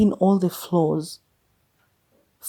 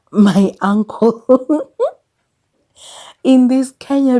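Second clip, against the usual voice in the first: breaking into laughter, higher and louder; real laughing; 7 semitones higher, 7 dB louder, slower than usual. Real, not acted. Asking if she's dating her uncle, laughing through it. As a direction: laugh through the words, pitch lifting.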